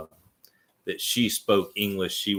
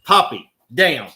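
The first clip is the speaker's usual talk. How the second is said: loud, high real dog command; getting ugly; 6 semitones higher, 11 dB louder than usual. Real, not acted. Owner telling Poppy to get down, getting ugly. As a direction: snap the order out loudly. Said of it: "hear me get ugly with Poppy".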